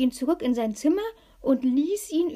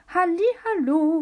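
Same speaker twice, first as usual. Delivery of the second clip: high, loud singsong greeting; fluting; 6 semitones higher, 6 dB louder than usual. A narrator's reading. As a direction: trill it out like a cheery fairy. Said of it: "flötete Pistacia".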